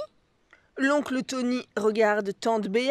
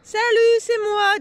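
bright greeting from the doorway, much higher and louder; cheery call; 9 semitones higher, 7 dB louder than usual. A narrator's reading. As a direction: call out from behind the door. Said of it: "dit une voix". (appel joyeux à la porte)

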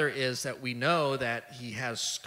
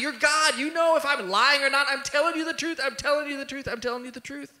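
loud, much higher, pained pleading; hurt; 13 semitones higher, 10 dB louder than usual. Acted. Peter answering Jesus's third question, hurt and pleading. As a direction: cry out wounded that he's doubted. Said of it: "Peter is hurt".

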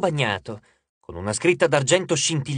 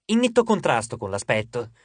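usual speaking voice

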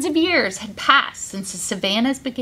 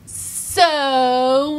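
sassy drawn-out so. Shown as a demonstration. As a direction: give it attitude. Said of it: "give me a sassy so".

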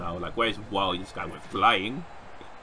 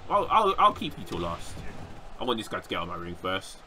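ordinary delivery

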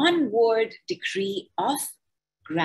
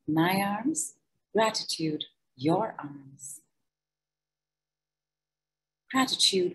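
guided gratitude meditation. Acted. Leading listeners through a body gratitude decree, calm meditation voice. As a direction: guide them gently through each body part.

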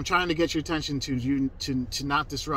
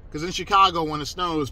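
ordinary talk, nothing marked

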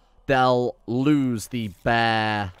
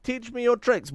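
a much higher, pleading read; pitched-up mock supplicant; high mock plea; 10 semitones higher than usual. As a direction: squeak the request up high. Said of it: "choosing quoted option aloud".

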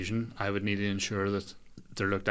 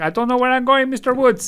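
much higher, louder bewildered Belfast voice; Shankill delivery guy; 9 dB louder than usual. Retold or imitated. Lost delivery guy addressing Mister Woods, high bewildered Belfast voice. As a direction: squeak it out like a lost Shankill lad. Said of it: "That's the guy from the Shankill".

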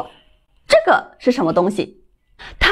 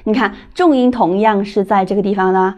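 usual speaking voice

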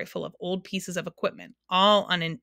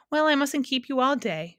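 higher-pitched, brisk dismissal; impatient; 6 semitones higher, 3 dB louder than usual. A narrator's reading. Sound keeper sending Milo away, impatient. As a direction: hurry them off impatiently. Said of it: "she said impatiently".

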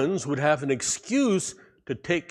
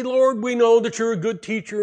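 flattering, higher and louder; buttering him up; 7 semitones higher, 6 dB louder than usual. Acted. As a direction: lay on the flattery to win him over. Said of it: "they really butter him up".

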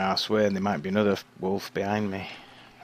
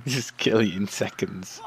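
usual speaking voice

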